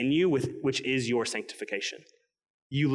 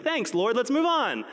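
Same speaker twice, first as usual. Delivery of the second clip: higher, mock-grateful brush-off; a sarcastic thanks, eager to be done; 9 semitones higher than usual. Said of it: "And you're like".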